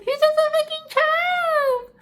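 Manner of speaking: exasperated outcry. Real, not acted. Upset over the child's plight.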